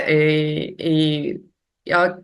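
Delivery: ordinary talk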